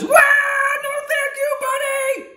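very high, slow squeaky cry of surprise; shrill cartoon squeal of surprise; squeezed octopus. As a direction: squeal it like you're being crushed in a hug.

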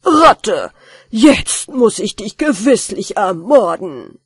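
murderous threat. Acted. Master threatens the rat, loud furious roar.

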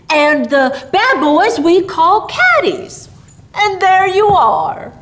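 hearty, drawn-out explanation; jolly Santa explaining. Acted. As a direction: lay it out slowly and grandly for a child. Voices Santa explaining to Billy.

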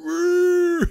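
loud, high burst of real laughter. Real, not acted. Laughing at the blaring horn.

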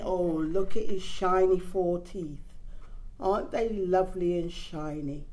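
ordinary delivery, nothing marked

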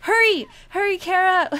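high, loud urgent plea; frantic gamer urging a character on; urgent shouting. Real, not acted. Urging game lifeguard to rescue.

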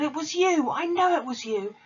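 high accusing roar; hammed-up headmistress accusing the girl; Trunchbull accusing. A narrator's reading. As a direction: jab the blame at her. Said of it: "she roared".

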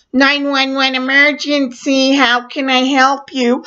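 a hint of a professional emergency operator. 911 operator answering.

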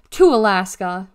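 loud, firm order at normal pitch; command. A narrator's reading. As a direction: send the child off with a forceful command. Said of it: "Miss Niederbaum commanded".

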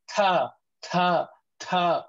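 잇몸에 혀를 댄 잘못된 ta; a mistaken ta with the tongue on the gums; wrong ta. Shown as a demonstration. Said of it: "then they say like this".

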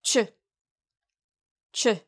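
unstressed 추, like "chuh"; teacher's quick, light 추; short and light. Shown as a demonstration. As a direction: toss off 추 briefly. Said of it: "짧고 가볍게 발음하세요".

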